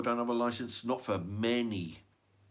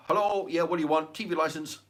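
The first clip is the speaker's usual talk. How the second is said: a raised, higher call down to the street; calling out the window; 6 semitones higher, 4 dB louder than usual. Pretend. Leaning out the window to the licence man, a raised call. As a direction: shout down from the upstairs window.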